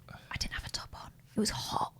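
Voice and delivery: whispered aside; hushed, embarrassed confession; confiding whisper. Real, not acted. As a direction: drop to a whisper, letting them in on it. Confiding aside within a story.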